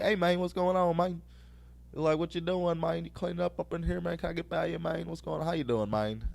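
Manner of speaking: lightly voiced Puerto Rican accent, easygoing; a hint of a Puerto Rican dude saying hi. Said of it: "He sounded all Puerto Rican and shit".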